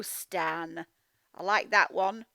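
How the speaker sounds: normal talking voice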